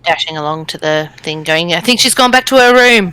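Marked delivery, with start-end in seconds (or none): 1.7-3.1 raised, higher-pitched shout down the hall; calling out. Acted. Dashing player tells the others where she went, calling out. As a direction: call it out loudly to the party.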